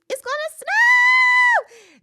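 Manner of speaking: loud mock news alert; shout. Real, not acted. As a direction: call it out loudly. Shouted weather update.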